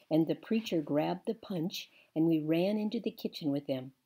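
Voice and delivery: ordinary delivery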